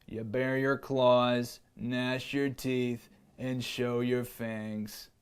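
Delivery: a slow, low lion showing how it's done. Acted. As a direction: growl it out slowly like a patient lion. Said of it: "said the lion".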